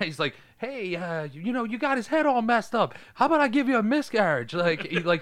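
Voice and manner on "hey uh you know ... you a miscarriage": higher-pitched imitation of a berating; lightly hammed-up scolding, pitch climbing; mock yelling. Paulie yells at his sister, imitated with mild mock anger. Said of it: "he yells at her".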